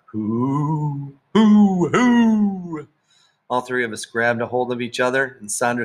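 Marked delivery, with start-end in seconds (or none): 0.1-2.9 high, loud ghostly hoo calls; a hidden prankster's eerie hooting; spooky hooting. A narrator's reading. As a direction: hoot like a ghost to scare the kids. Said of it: "we all heard a scary noise".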